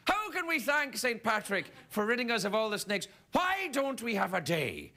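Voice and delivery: Irish folk founding a feast day, hammed-up and grand; mock Irish proclamation. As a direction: announce the holiday like a pompous elder. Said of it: "because it's like".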